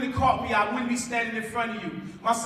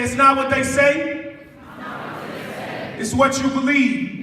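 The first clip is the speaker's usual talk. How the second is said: call to repeat; 3 semitones higher, 4 dB louder than usual. Shown as a demonstration. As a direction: lead the crowd in a chant. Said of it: "Everybody repeat after me".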